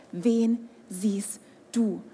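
slow, intimate whisper; whispered question. Acted. Preacher whispers the question to the congregation. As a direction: whisper it slowly, as if telling a secret.

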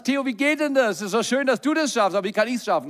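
high, mock-eager asking; pleading questioner. Listener asking the preacher how to manage it, pleading.

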